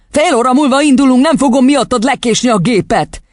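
high, forceful scolding; angry father. A narrator's reading. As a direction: berate the child impatiently. Father shouts at daughter.

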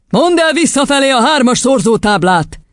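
high-pitched imperious order; commanding. A narrator's reading. Headmistress demanding the times table backwards, commanding. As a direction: command with no room for argument. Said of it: "parancsolta neki az igazgatónő".

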